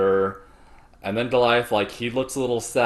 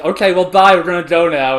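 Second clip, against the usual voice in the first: loud, rushed nervous goodbye; hurried mock goodbye; 7 semitones higher, 10 dB louder than usual. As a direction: blurt it out and flee. Trio hurriedly leaving.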